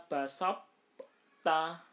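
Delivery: "basop ta" with a heavy separate stop before the ta; unmerged to, wrong. Shown as a demonstration. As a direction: loudly break "basop ta" in two, first consonant not merged. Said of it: "Ndak boleh dibaca".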